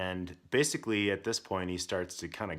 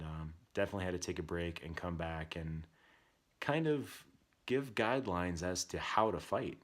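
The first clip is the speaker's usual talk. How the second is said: ordinary delivery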